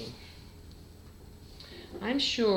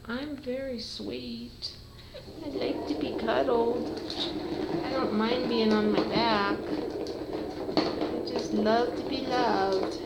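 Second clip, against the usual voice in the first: lightly raised, slow cat voice; a cuddly kitten speaking, a touch higher; voicing the sweet cat; 6 semitones higher than usual. Owner voicing her cuddly kitten, light and sweet. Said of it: "he's like".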